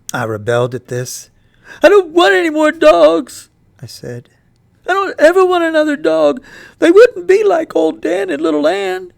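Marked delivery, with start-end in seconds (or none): 1.8-9.1 put-on high, loud, breaking boy's voice; boy sobbing over his dead dogs; grieving boy. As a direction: cry it out to mama in protest. Boy rebels against more dogs.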